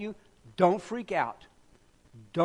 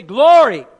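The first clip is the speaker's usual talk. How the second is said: loud call of Glory; 3 semitones higher, 14 dB louder than usual. Shown as a demonstration. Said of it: "Everybody say".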